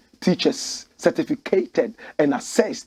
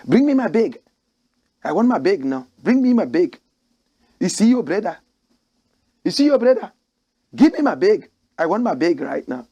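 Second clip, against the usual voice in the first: loud, high mock South African demand; imitating South Africans; 3 semitones higher, 4 dB louder than usual. Host imitating a South African demanding his bag, loud. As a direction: bark the order like a South African abroad.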